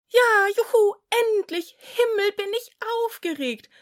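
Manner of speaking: very high, loud, fast cheer; excited cheering. A narrator's reading. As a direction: kids bursting with excitement. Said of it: "riefen durcheinander".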